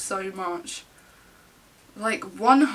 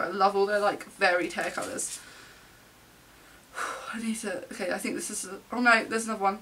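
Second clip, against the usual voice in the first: ordinary talk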